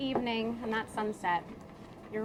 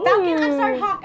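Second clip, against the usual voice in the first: flustered; 6 semitones higher, 15 dB louder than usual. Real, not acted. Flustered correction, very loud.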